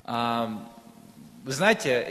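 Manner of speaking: ordinary talk, nothing marked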